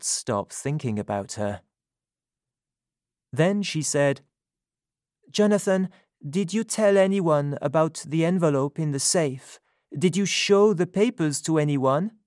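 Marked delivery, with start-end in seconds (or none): 5.3-12.2 higher, louder woman's voice, indignant; Sophie's voice. A narrator's reading. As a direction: speak up as her, indignant. Sophie questions Jonathan.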